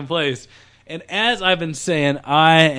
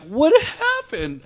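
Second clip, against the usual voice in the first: alarmed question; 12 semitones higher, slower than usual. Retold or imitated. Scared kid asks, pitch jump.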